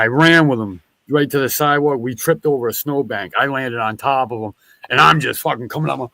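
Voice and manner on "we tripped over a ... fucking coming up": ordinary talk, nothing marked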